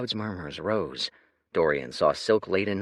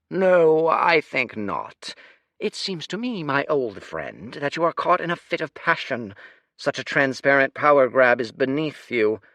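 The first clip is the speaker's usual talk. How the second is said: condescending; 5 semitones higher, 6 dB louder than usual. Acted. The Lord chiding the general like a petulant child. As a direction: talk down like to a child. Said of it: "like a parent addressing a petulant child".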